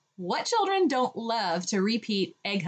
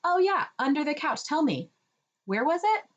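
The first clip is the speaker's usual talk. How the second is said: higher, eager prompting; encouraging toddler talk; 8 semitones higher than usual. Shown as a demonstration. Parent prompting child to repeat, encouraging. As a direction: prompt the child warmly to answer. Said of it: "you would just repeat".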